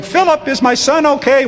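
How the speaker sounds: high, anxious call; frantic mother rushing down; worried mother. Retold or imitated. Mother rushing down the steps, anxiously asking about her son. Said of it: "coming down the steps going".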